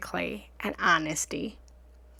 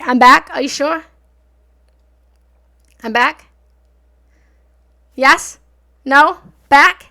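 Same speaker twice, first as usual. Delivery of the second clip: calling out; 5 semitones higher, 17 dB louder than usual. Real, not acted. Testing mic, calling out.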